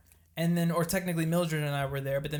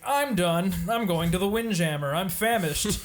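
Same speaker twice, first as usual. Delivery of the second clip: lightly imitated stand-up, louder; comedian on stage; 3 semitones higher, 5 dB louder than usual. Comedian announcing he's off to the Windjammer, lightly projected stage voice. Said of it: "And then he's like".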